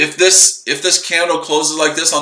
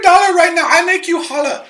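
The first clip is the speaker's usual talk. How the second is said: exaggerated Indian accent pitched much higher; mock Indian accent; 14 semitones higher than usual. Mocking a phone scammer, much higher mock Indian accent. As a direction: ham up a fake Indian accent. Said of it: "I speak India's accent".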